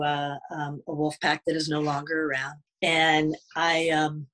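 ordinary delivery

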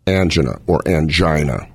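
angina as AN-juh-nuh, then as an-JY-nuh; two pronunciations. Shown as a demonstration. Doctor naming the condition, angina said both ways. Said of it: "you can pronounce it either way".

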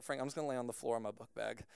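low, soft and quick, defeated; hushed, fast retold grumble; resigned. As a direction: quietly give up on the bed. Retold giving up on bed.

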